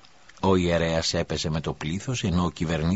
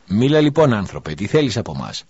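loud, higher, pressing the prisoner to speak; insistent demand; 4 semitones higher, 7 dB louder than usual. Acted. Governor presses the silent prisoner, insistent and loud. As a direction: bark the order to talk. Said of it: "Ο κυβερνήτης επέμεινε".

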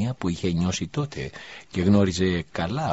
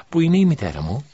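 put-on higher, louder young boy's voice; boy's question; 8 semitones higher, 8 dB louder than usual. A narrator's reading. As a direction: voice the boy waking up and asking. Boy wakes, asks for mother. (παιδική φωνή, πιο ψηλά)

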